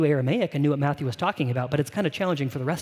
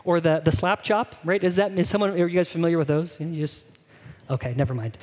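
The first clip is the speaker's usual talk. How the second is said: normal talking voice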